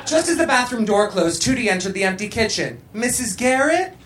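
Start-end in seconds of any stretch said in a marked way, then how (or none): 2.9-4.0 rising, questioning call. A narrator's reading. Tootie calls into the empty kitchen, calling out. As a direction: call for someone, pitch lifting on each name. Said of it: "she called out".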